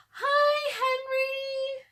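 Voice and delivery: high, loud call down to the dog; shouted greeting. Acted. Annie shouts hello to the neighbor's dog far below. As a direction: yell a cheerful hello from way up. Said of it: "shouted Annie".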